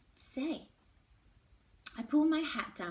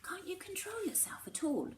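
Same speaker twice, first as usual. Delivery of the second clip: pitched-up breathless whisper; urgent whisper; 11 semitones higher than usual. A narrator's reading. Alberic pressing her about the ice. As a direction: hiss it out under your breath.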